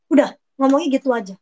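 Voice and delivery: normal talking voice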